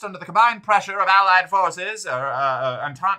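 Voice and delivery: high, loud Mid-Atlantic wartime newsreel announcer; Mid-Atlantic accent. Shown as a demonstration. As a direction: belt out front news in a Mid-Atlantic accent. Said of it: "only speak in Mid-Atlantic".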